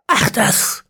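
high airy brush-off; dismissive old man. A narrator's reading. Ezekiel dismissing the worry, high and airy. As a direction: wave it away.

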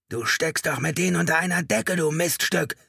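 high, loud accusing yell; hysterical, high-pitched outburst; furious scream. A narrator's reading. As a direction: scream abuse at someone beside you. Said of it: "schrie Chalker".

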